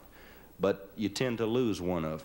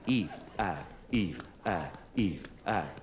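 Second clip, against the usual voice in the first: low eve ive chant; 3 semitones lower, slower than usual. Shown as a demonstration. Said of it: "Let me hear you do it now".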